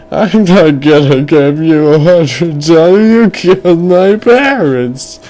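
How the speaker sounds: put-on high, wailing kid protest; outraged crying boy. Boy refuses his parents' killer, high tearful outrage. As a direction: wail it like a furious child. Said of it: "And the boy's like".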